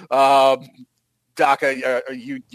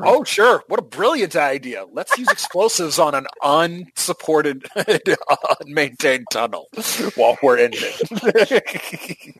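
sarcastic. Real, not acted. Game master answers player's grenade idea, sarcastic. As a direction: pour sarcasm on a bad plan.

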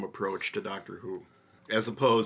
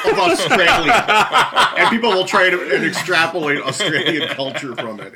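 laughing through words; 6 semitones higher, 12 dB louder than usual. Real, not acted. Joking about post-apocalyptic Australia.

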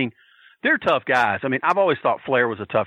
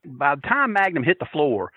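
ordinary talk

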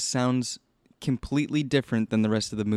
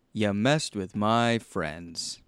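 slow, drawn-out movie line; mock movie-character voice; much slower than usual. Podcaster mimics the movie's odd audio, slow mock delivery. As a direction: draw it out slowly, imitating overdone film dialogue. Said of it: "the audio is really, like, you know".

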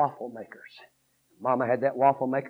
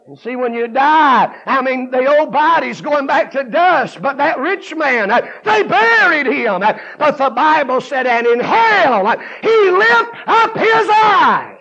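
high, shouted sermon building in pitch; full-force preaching; 13 semitones higher, 15 dB louder, slower than usual. Real, not acted. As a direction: preach it loud, driving phrase after phrase.

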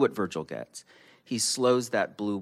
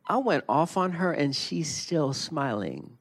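voiced quote; 8 semitones higher than usual. A narrator's reading. Quoting Virgil himself.

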